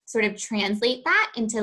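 ordinary talk, nothing marked

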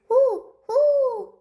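two high, loud owl calls; owl hoots. A narrator's reading. Owl calls out at a strange sound, high hoots. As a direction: hoot twice, high and ringing out. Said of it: "he called".

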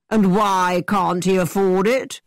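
a hint of a boastful gentleman, eager; lightly voiced brash young man. A narrator's reading. Thorpe hurrying Catherine into the carriage, cocky. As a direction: play Thorpe as cocky and pushy.

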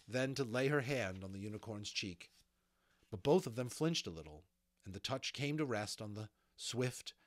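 usual speaking voice

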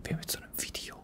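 hushed whisper, keeping silent. Real, not acted. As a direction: whisper every word.

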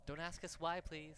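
high, loud pleading call; loud begging call to a stranger. Acted. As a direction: shout the plea up and out. Calling for help from well.

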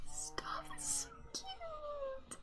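very high, soft, slow strangled whimper; squeaky cry. Real, not acted. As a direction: squeak it out, overcome by cuteness. Overwhelmed by cuteness.

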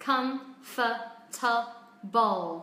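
"comfortable" broken into four slow, separate syllables; very slow "comfortable" with gaps between syllables. Shown as a demonstration. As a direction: spell out "comfortable" by sound, "come-fuh-tuh-bol". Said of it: "từ này có bốn âm tiết".

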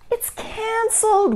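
high, drawn-out mock wail. A narrator's reading. Mother finds story time canceled, storybook wail. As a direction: wail it slowly, far up in pitch. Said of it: "wailed Ava's mother".

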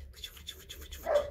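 soft unvoiced call to the pups; whispered calling. Real, not acted. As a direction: whisper fıçı fıçı to call them over.

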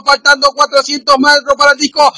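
ordinary talk

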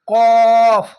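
কফ said heavy and loud, no a sound; thick heavy letter. Shown as a demonstration. Teacher showing the heavy letter, said thick. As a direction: thicken কফ in the throat. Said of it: "এখানে মোটা করে হবে".

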